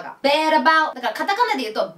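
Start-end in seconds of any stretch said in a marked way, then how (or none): 0.2-0.9 "bad about" run together as "beh-ra-bau"; linked bad about. Shown as a demonstration. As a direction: link D into about, say it "bera bau". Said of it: "ベーラバウでいいです". (「bad about」をベーラバウとつなげて)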